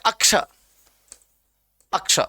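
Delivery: अक्ष, an added a before ksha; extra initial a. Shown as a demonstration. Teacher imitates misreaders of the letter, a-prefixed अक्ष. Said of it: "कई लोग इसको पढ़ते हैं".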